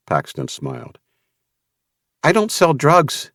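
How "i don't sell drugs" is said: emphatic. A narrator's reading. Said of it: "the tone of her voice emphatic".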